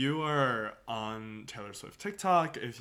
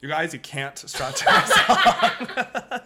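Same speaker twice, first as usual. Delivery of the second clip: laughing loudly; 6 semitones higher, 14 dB louder than usual. Real, not acted. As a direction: laugh through the words. Laughing verdict on strutting.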